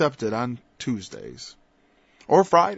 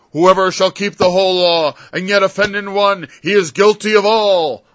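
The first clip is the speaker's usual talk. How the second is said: louder, higher, ringing scripture reading; declaiming scripture; 6 semitones higher, 10 dB louder than usual. Shown as a demonstration. Preacher demonstrating how to speak the law, declaiming. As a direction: proclaim the verse like from a pulpit. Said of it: "Speak like this".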